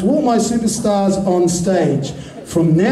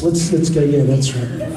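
ordinary talk, nothing marked

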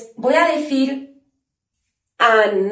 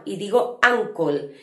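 normal talking voice

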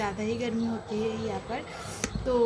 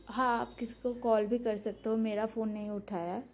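straw between lips; sipping then speaking; talking while sipping through straw. Real, not acted. Seen 0: straw in mouth while speaking, under sheet face mask.

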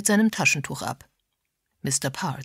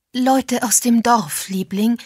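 higher, louder, slow and over-bright; anxious wife; 6 semitones higher, 5 dB louder, slower than usual. A narrator's reading. Mrs Parr explains visitors to her husband, forced cheer. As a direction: sing out a placating explanation to your husband.

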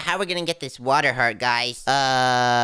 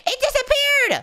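high-pitched shocked squeal; dramatic high shout of surprise; startled cry; 4 dB louder than usual. Pretend. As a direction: yelp it high in disbelief. Fish vanished before player.

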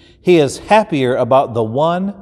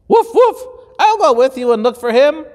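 dog voice; 12 semitones higher, faster than usual. Acted. Dog offering to help the shepherd, high and eager. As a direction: bark it out like an eager puppy. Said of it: "says the dog".

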